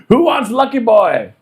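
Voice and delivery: mock an excited colleague rallying everyone; hollering coworker. Continues coworker's excited food announcement.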